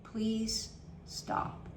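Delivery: soft unvoiced "Please stop"; whispered plea. Shown as a demonstration. Coach pleading with an intrusive thought, whispered. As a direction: beg it quietly.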